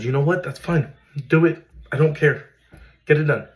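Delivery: loud, high, pushing it through; urgent go-ahead. Retold or imitated. Father telling doctors to do the spinal tap, loud and urgent.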